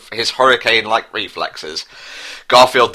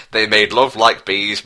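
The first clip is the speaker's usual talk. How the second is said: ordinary talk, nothing marked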